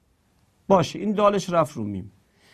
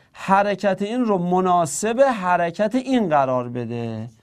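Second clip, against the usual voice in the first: usual speaking voice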